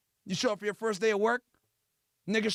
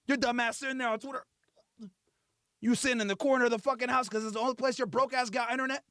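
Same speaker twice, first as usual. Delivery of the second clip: ordinary talk, nothing marked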